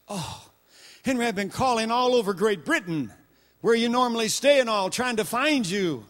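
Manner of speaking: loud, high anxious wife on the phone; hint of a panicked wife calling her husband; frantic wife. Wife on the phone telling Henry she searched everywhere, anxious.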